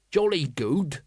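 usual speaking voice

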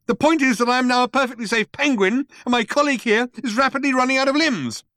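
high-pitched indignant tirade; raging. A narrator's reading. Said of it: "raged Ford".

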